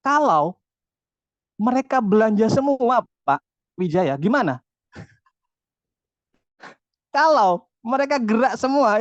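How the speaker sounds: challenging comeback. Retold or imitated. Speaker answering his negative thoughts, challenging. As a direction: fire the question back at the doubt. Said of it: "saya akan membalik".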